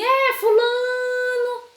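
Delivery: high, sing-song long-distance call; mother calling out. Acted. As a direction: stretch the vowel out and hold it high. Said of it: "Ela não chama assim".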